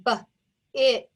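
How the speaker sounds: "B, it" broken into separate sounds; sounding out. Shown as a demonstration. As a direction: tap out "B, it" sound by sound.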